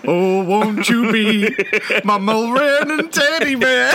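begging the teacher, then crooning like [name]; [name] impression. Schoolboy serenading the head of year, in a hammed-up [name] croon. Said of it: "I'll do my [name] for you".

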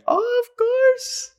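very high, slow squeal of delight; squeaky gushing. Real, not acted. Touched by a fan's thanks, squealing very high and slow. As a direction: stretch it out in a tiny high voice.

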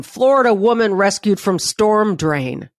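ordinary talk, nothing marked

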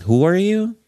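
very high, haughty who are you; dismissive high voice. Retold or imitated. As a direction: act the cold boss who forgets you. Dismissive boss line repeated.

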